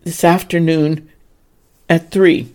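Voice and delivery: loud, booming father's bellow. A narrator's reading. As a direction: bellow it across the stable. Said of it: "he bellowed".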